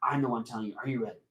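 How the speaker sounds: quick, very soft plotting; conspiratorial aside. Acted. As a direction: let the kids in on a secret plan. Puppet plotting secretly with the kids.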